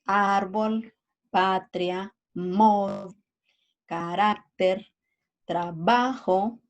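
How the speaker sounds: ÁRbol, PAtria, MOd, caRÁCter, traBAjo, stressed vowels stretched; penultimate-syllable stress. Shown as a demonstration. Teacher giving examples of graves, penultimate stress on árbol, patria, carácter, trabajo. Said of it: "la acentuación en la penúltima sílaba".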